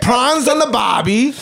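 put-on high-pitched Aussie accent; mock Australian accent. One-upping a fellow fake Aussie, high Australian accent. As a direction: call it out as a cartoon Australian. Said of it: "I am from the land down under".

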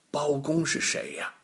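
plain reading voice

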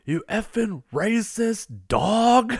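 loud, high, drawn-out mock yell; retold yell. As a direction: imitate an outraged shout, stretching the words. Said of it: "he yells".

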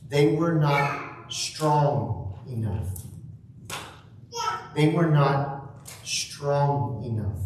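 slow, each word weighted; slow emphatic phrase. Shown as a demonstration. Preacher stresses the Greek sense, slow and weighted. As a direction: lean on "they were not strong enough" slowly. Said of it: "the emphasis in the Greek is literally".

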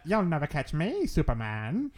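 put-on slow old-time movie villain taunt; forties villain. Blob Kelly escaping Superman into a sewer, forties villain voice. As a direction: put on a drawn-out 1940s bad-guy voice. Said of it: "he sounds like that because it's the forties".

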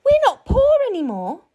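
high, quick, overjoyed mother; delighted cry. A narrator's reading. Mother rejoicing at Jack's gold, high and overjoyed. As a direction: rejoice in a high-pitched rush. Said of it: "cried Jack's mother".

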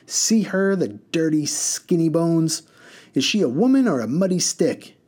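mock her rival; spiteful mud woman. A narrator's reading. Second mud woman sneering at the first woman.

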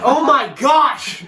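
loud, high, drawn-out exclamation; slow, hammed-up disbelief; exasperated outburst. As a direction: cry out in laughing exasperation. Retold exasperation at repeated question.

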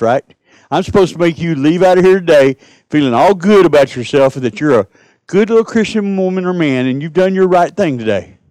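ordinary delivery

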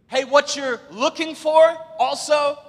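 high, loud interrupting call; shouting to a crowd. Retold or imitated. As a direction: holler it out to the whole crowd. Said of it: "a man stands up and says".

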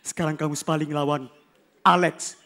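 low, quiet stern teacher; a hint of a stern coach. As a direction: coach calling the sparring match. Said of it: "Guru gue pernah bilang".

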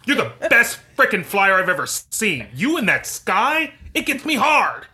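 loud, high, hammed-up commander; yelling commander. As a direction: bark like a worked-up commanding officer. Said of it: "So it's like, you know".

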